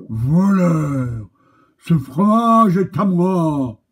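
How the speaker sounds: loud furious cow voice; the cow claims the cheese; enraged cow. Acted. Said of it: "dit la vache enragée".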